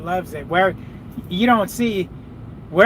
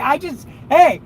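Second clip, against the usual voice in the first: ordinary talk, nothing marked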